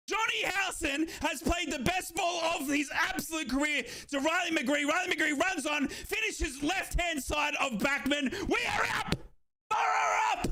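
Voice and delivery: high, shouted live commentary of the goal; excited goal call. Real, not acted.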